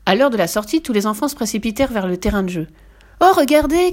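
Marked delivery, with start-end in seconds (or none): 3.2-3.9 much higher and louder shout; children's delighted cry. A narrator's reading. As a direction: shout with joy at the new swing. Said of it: "crièrent-ils". (les enfants crient de joie devant la balançoire)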